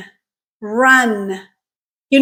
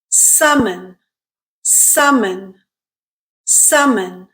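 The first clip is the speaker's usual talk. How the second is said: "Summon" with stress on the first syllable, SUM-mon, falling; careful model "Summon", strong uh first, weak ending; stressed syllable drill; 4 semitones lower, much slower than usual. Shown as a demonstration. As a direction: say "Summon" alone, SUM-mon, for learners to copy. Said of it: "What syllable is stressed?".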